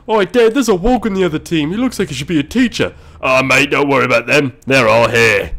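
loud, high put-on Australian voice; Australian accent. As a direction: ham up an Aussie accent as a cheeky driver. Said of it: "I'm a fucking Australian driver".

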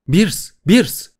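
high-pitched cry for a companion; alarmed calling out. Acted. Calling to a companion who has just vanished, alarmed high cry. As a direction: call the name out in alarm, voice leaping up.

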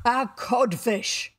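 loud, slow scornful verdict; mimicked captain's scorn. A narrator's reading. Boy insults the captain in his voice, scornful. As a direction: pronounce the insult, drawing it out. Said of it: "replied the voice".

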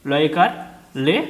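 ordinary talk

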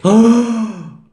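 loud, high voiced gasp; big theatrical intake of shock. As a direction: gasp aloud in dramatic horror. Nuns shocked by the mark.

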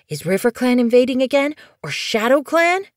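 high, quick, excited questions; eager kitten. Acted. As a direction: ask it fast and high like an eager kit.

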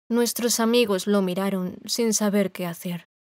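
usual speaking voice

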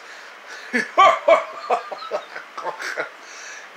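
very high breathy cartoon giggle; a squeaky laugh; high silly cartoon laugh.

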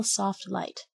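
usual speaking voice

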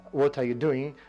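ordinary talk, nothing marked

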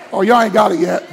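ordinary delivery

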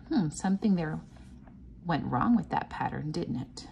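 ordinary talk, nothing marked

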